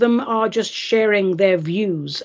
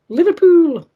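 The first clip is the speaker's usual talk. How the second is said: a high, loud stab at a Scouse "Liverpool."; host trying a Liverpool accent on the city's name; attempted Liverpool accent; 9 semitones higher, 6 dB louder than usual. Shown as a demonstration. Reading listener's town, attempting a Scouse accent. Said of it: "I can't do the accent".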